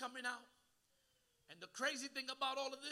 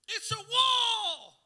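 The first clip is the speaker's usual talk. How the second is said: drawn-out shout; 11 semitones higher, 14 dB louder, much slower than usual. Acted. Preacher building intensity.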